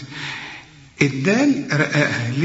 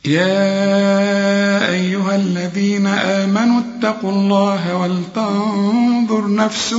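slow, higher, chanted tajweed; Quran recitation; 6 semitones higher, 3 dB louder, much slower than usual. Acted. Teacher recites the verses for students to memorize. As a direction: chant the verses slowly, melodic and full.

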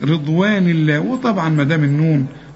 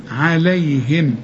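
normal talking voice

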